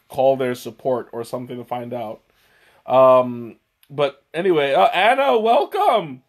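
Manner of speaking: ordinary talk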